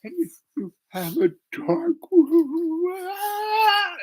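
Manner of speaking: high, slow tearful stammer breaking into a wail; hammed-up falsetto blubbering; bawling. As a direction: imitate a girl bursting into tears. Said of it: "And she starts bawling".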